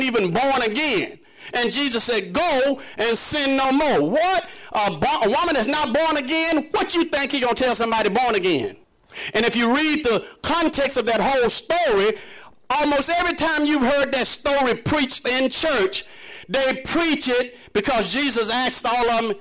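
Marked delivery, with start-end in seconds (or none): none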